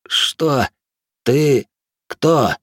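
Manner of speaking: high, strained, halting voice; dazed captive. A narrator's reading. Revived captive questions his captor, strained and bewildered. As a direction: push out one word at a time.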